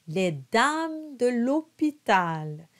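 slow, careful French: monta with a nasal O; slow French model. Shown as a demonstration. Coach reads the verse slowly for students. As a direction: sound out each French syllable. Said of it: "nice round closed O".

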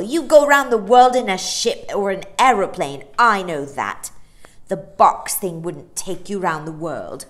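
put-on loud scornful boy. A narrator's reading. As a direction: dismiss it loudly. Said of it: "said Michael".

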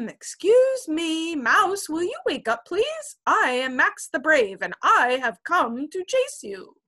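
high, loud brave little kitten; bold kitten. Acted. Kitten Max tries to wake the monster, bold. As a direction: announce yourself as a brave kitten.

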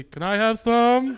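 high, loud rabbit plea, very drawn out; put-on pleading rabbit voice; cartoon rabbit. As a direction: beg slowly like a cereal-ad rabbit. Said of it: "the commercial with the rabbit".

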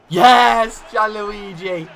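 loud high cheer for the keeper's save; joyful shout. Real, not acted. Manager cheering his keeper's penalty save, shouting. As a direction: roar his name in relief.

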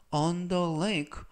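very slow, deliberate phrase; slow model reading. Shown as a demonstration. Teacher modelling phrase slowly for learners. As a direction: read it out word by word. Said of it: "활용 문장 보시겠습니다".

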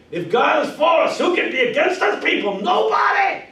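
loud, high shouting sermon; full preaching. Shown as a demonstration. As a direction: build to a thundering cry.

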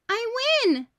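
put-on a girl's high, loud shout of victory; triumphant shout. A narrator's reading. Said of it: "Bridget shouted".

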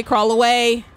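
usual speaking voice

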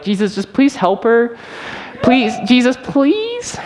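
very high, squeaky praying plea; a twelve-year-old pleading in a squeaky voice; squeaky kid voice. Retold or imitated. Twelve-year-old praying for pastor's wife, squeaky high plea. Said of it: "My voice was really that squeaky".

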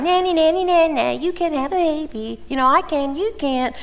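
high singsong playground mockery; childish taunt. Rival wife taunting childless Hannah, high singsong mockery. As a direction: tease her like a spiteful rival. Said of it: "this other woman that is provoking you all the time".

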